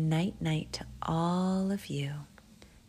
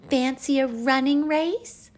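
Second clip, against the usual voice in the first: put-on high, loud child character; little one keen to play; eager invitation; 9 semitones higher, 9 dB louder than usual. Albie inviting cheetahs to race, high and eager.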